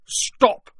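loud, high-pitched order; commanding. A narrator's reading. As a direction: command a halt at full voice. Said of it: "he commanded".

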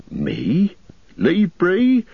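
alarmed, high-pitched protest; scared innkeeper. Acted. Landlord frightened by Strider's request, high alarmed voice. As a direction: recoil at the idea of leaving. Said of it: "looking really scared".

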